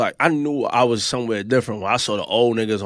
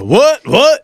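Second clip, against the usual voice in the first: high, loud challenging "what?"; mock confrontation; 11 semitones higher, 5 dB louder than usual. Mimicking a stranger arguing with her, loud mock challenge. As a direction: mimic an aggressive man squaring up.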